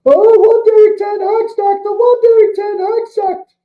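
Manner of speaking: high, whiny mock fan voice. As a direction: sneer it like a clueless fan moaning. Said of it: "all you half-wits in this fucking fandom".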